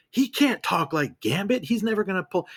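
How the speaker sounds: lightly voiced griping fan; mocking whiny fan. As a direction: whine the fans' complaint back at them. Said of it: "that was the number one complaint".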